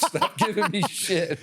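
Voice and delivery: high, slow laughing retort. Real, not acted. Husband laughs while telling wife to stop nagging. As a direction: laugh through the words. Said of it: "laughs at own retort".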